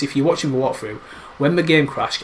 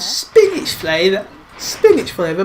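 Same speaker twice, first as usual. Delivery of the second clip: higher, slow sing-song repeat; mock echo; 5 semitones higher, 4 dB louder, slower than usual. Echoing the game's Spinach flavour in a higher sing-song. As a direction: parrot the phrase playfully, drawn out. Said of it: "echoing the game line".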